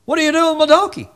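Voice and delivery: high, fast, outraged owner; mock angry donkey owner; indignant owner. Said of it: "and it's like".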